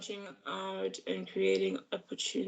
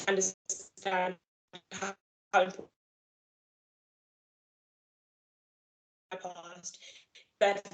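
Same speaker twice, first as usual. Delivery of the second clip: breaking up; 4 dB quieter than usual. Real, not acted. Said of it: "you were just, um, hawking a little bit".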